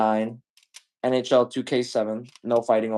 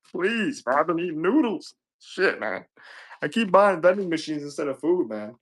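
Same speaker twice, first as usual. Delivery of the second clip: whiny begging broke guy; 5 semitones higher than usual. Pretend. Broke viewer begging the seller.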